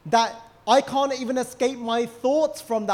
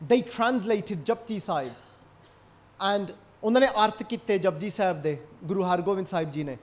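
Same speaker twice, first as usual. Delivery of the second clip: ordinary talk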